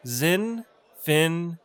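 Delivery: Zinfandel split up, ZIN... fan... DEL; syllable-by-syllable pronunciation. Shown as a demonstration. Teacher breaks down a wine name, syllable by syllable. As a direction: say Zinfandel slowly in three separate pieces. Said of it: "It's pronounced".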